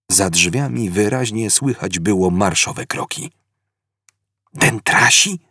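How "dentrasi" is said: usual speaking voice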